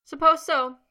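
high, loud and cheerful; breezy, not sorry at all; unrepentant. A narrator's reading. Potter teases grumbling Snape, loud and breezy. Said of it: "not sounding regretful at all".

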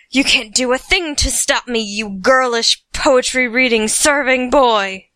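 loud taunting shout. Acted. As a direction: sneer and shout it. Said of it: "Uther shouted again".

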